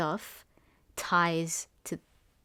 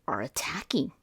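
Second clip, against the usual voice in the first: whisper; 4 semitones lower than usual. Retold or imitated. Whispered awe.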